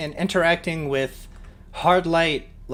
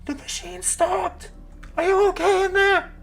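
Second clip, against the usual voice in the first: muffled, shouting through a door. Acted. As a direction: call out through a thick door. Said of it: "a muffled voice, you hear her say".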